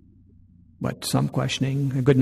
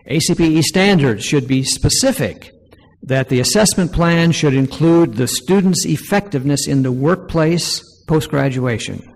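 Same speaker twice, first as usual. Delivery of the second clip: loud, projected reading of a formal statement; louder, measured proposal-reading voice; formal read-aloud; 3 semitones higher, 7 dB louder than usual. Real, not acted. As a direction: read it out firmly to a room. Reads numbered recommendation aloud to audience.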